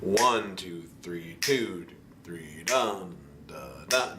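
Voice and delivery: waltz count with the first beat leaned on. Shown as a demonstration. Piano teacher counting three four for the student. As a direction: count it out, weight on one. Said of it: "So it's like".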